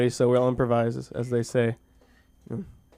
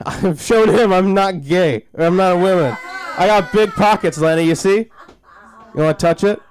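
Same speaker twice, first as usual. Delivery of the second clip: loud, raised, over-the-top bragging; mock macho boasting; 6 semitones higher, 7 dB louder than usual. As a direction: show off loudly to prove your manhood. Boastful proof of manhood.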